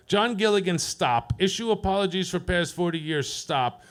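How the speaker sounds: stilted dictation with a falling, separate "stop"; telegram read-out. Acted. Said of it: "They sent me a telegram".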